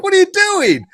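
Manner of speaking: high, loud indignant cry; mock outrage. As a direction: imitate an offended family member, voice leaping up. Acting out offended family in retold scene.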